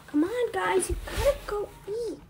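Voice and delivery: high, insistent urging; coaxing call. Pretend. Calling everyone to come eat, coaxing call. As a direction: call them over with pleading insistence.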